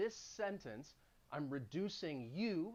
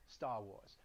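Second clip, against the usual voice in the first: Boston accent; 7 semitones lower, 6 dB quieter than usual. Shown as a demonstration. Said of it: "Dropping the R's".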